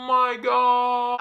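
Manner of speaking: hugely drawn-out "oh"; shocked onlookers. Retold or imitated. Imitating everyone after she sits down, exaggerated shock. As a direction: stretch it out as an imitated crowd reaction.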